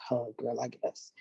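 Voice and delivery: low, soft and offhand; unimpressed quiet shrug of a reply; dismissive mumble. Retold or imitated. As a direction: brush her off with a bored murmur. Mimicking the girls' dismissive reply.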